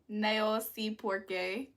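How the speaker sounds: slow, careful não sei por quê; careful Portuguese. Shown as a demonstration. Streamer trying Portuguese for chat, slow. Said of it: "I said, "I don't know why".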